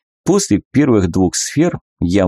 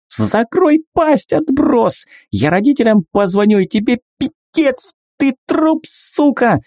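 shrill furious screaming, much higher; hysterical rage. Acted. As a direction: lose it and scream threats. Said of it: "сорвалась Милана".

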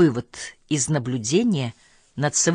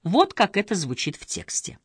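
ordinary delivery

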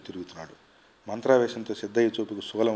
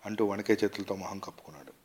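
usual speaking voice

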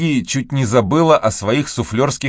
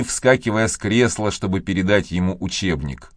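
plain reading voice

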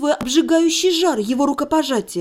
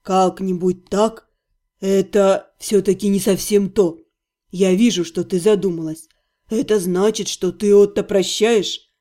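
calm healer; 7 semitones lower than usual. A narrator's reading. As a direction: gently probe her. Healer voiced, lower.

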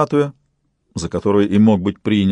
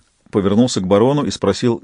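ordinary delivery

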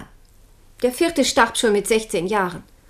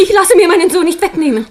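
higher, louder, bitter laughing defiance; defiant outburst; 6 semitones higher, 7 dB louder than usual. Acted. Mother refusing to give up her son, defiant. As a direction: refuse outright with a scornful laugh.

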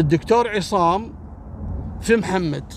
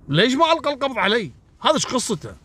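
higher, faster, puzzled questioning; baffled disbelief; 8 semitones higher, faster than usual. Acted. The baffled doctor asks himself, higher, in disbelief. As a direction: ask it as if the world makes no sense.

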